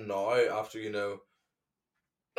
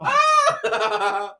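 laughing shriek; 9 dB louder than usual. Real, not acted.

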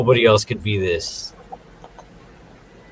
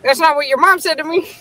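very high squawky parrot; mock parrot voice. The parrot boasting to Cindy, very high squawk. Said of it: "And he's like".

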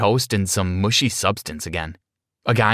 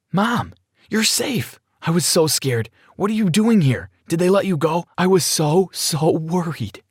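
mild acted trembling; 7 semitones higher than usual. A narrator's reading. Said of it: "I said while trembling".

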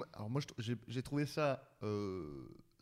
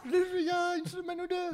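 laughing insistence; 6 dB louder than usual. Real, not acted.

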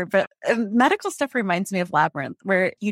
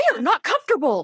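mock pity; 7 semitones higher than usual. Mocking researchers' discomfort.

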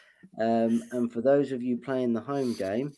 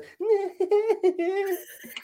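high-pitched mock startled titter; very high, put-on nervous squeal; startled Barbie imitation; 4 dB louder than usual. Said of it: "Barbie absolutely craps her pants and turns".

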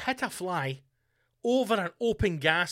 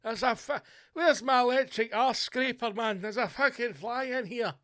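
lightly acted panicky old man, pitched higher; hallucinating pensioner; 7 semitones higher than usual. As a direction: play a gas-addled man hunting a fly. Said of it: "is he just hallucinating for all the gas".

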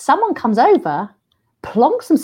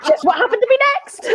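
high laughing outburst; helpless laughing; 13 semitones higher, faster than usual. Real, not acted. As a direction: laugh hard through it. Laughing at own story.

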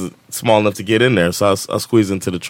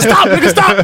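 high, louder yell through laughter; acted panicked plea; 5 dB louder than usual. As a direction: imitate a frantic cry to stop, laughing. Acts out friend falling out of car.

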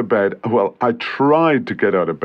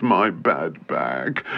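pained groan; 8 semitones lower, 5 dB quieter, much slower than usual. Acted. Complaining about back pain.

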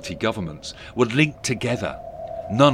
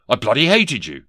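louder, irritated comeback; snappy retort; 4 semitones higher, 6 dB louder than usual. Acted. Ghost contradicts Scrooge's flattery, snapping. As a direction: snap it back at him. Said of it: "snapped Johnson Marley".

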